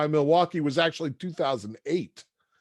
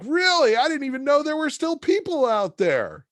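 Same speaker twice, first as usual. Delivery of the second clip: much higher, chipper salesman voice; cheery Joe; 5 dB louder than usual. Retold or imitated. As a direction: sell Milwaukee like a booster. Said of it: "He's like".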